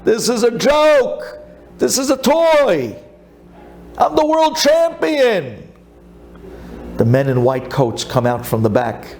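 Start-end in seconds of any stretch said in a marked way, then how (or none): 0.0-5.7 put-on high, slow, boastful accented champion; boastful Kasparov. Kasparov mocks the machine, high and slow. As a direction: lord it over the machine, slowly. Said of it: "and he says".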